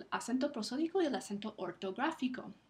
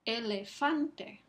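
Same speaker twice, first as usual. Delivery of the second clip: slow "Elefante", leaning on FAN; stress on FAN; much slower than usual. Shown as a demonstration. Teacher repeats for listening students, slow stress on FAN in "Elefante". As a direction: draw out the word, emphasizing FAN in "Elefante". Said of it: "Fan es la sílaba que recibe".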